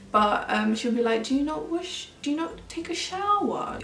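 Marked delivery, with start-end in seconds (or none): 1.2-3.8 put-on higher, innocent child voice asking; lightly voiced curious little girl; polite little girl. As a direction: mimic a polite nine-year-old. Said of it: "she said it so politely".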